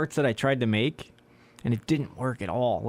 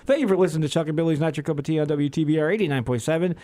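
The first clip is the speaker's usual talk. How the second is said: radio announcer; 4 semitones higher, 4 dB louder, faster than usual. Acted. Radio sign-off read.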